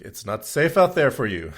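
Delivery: loud, urgent mock Indian cab driver; Indian accent. Cab driver warning the comedian off the street, Indian accent. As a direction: warn him loudly in an Indian accent. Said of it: "in a thick Indian accent".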